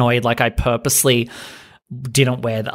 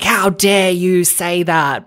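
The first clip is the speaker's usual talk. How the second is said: mock outrage; 5 semitones higher, slower than usual. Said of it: "They're like".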